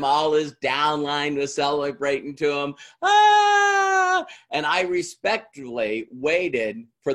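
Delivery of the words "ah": a sudden high, loud whoop of celebration; mock cheer. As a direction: burst out like the cheering upline. Crowd celebrating on stage.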